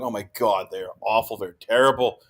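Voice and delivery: a low, understated imitation of mockers; mocking. Fans writing off Vanderbilt for years, mocking. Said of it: "which for years we're mocking".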